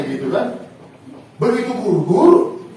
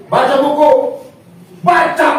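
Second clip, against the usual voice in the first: higher, louder command to read; urgent exhortation; 6 semitones higher, 5 dB louder than usual. Real, not acted. Preacher urging young people to read, loud and high. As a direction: drive the command home.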